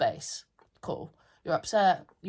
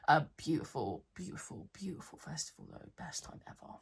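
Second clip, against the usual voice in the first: drops to a near whisper; wistful aside; 2 semitones lower, 8 dB quieter than usual. Real, not acted. Softly to the camera about the festival, near whisper. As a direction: murmur it like a private aside.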